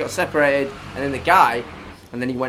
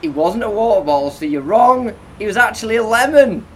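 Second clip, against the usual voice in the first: louder, much higher gloating announcement; triumphant reveal; 9 semitones higher, 9 dB louder than usual. Real, not acted. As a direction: crow the answer like a game host.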